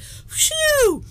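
a sudden high blown-out phew, spraying coffee; spit-take whoosh. Retold or imitated. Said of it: "And I just went".

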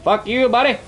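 screamed high and loud at the enemy; furious taunt. Real, not acted. Gamer screaming a taunt at the enemy player. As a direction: yell it at him like a war cry.

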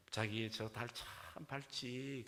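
slow, lingering Seoul bride; Seoul accent bride. Acted. Seoul bride admires the moon to her groom, slow Seoul accent. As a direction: muse sweetly in drawn-out Seoul speech. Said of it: "서울 신부가".

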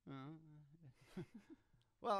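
ordinary talk, nothing marked

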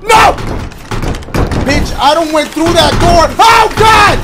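terrified high-pitched scream; scared screaming. Real, not acted. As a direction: shriek in real fright. Jump scare in horror game.

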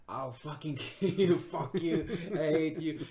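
high, loud, laughing imitation of her yelling; mock angry screaming. Second girl yelling threats at the comic, imitated high and loud. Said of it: "she stands up and she goes".

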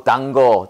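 "단거" with a hard g, for danger; mock the misreading "단거", dan-geo. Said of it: "단거를 읽었어요".

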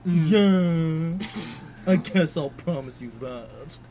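put-on low, slow old man voice; creaky old geezer reading. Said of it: "Oh, in an old man voice?".

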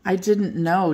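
ordinary delivery, nothing marked

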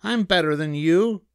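high, cocky rabbit retort; high-pitched one-upmanship; snapping rabbit. A narrator's reading. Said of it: "snapped the rabbit".

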